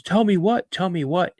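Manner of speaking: put-on high-pitched, curious kid's questions; eager little brother. Little brother walking in on a secret, eager questioning. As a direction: pipe up high, eager to be let in.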